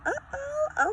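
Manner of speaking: sudden high squeaky uh-oh; startled at her watch, voice jumping way up; surprised uh-oh. Retold or imitated. Mother checking her watch mid-walk, high startled uh-oh. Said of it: "and I was like".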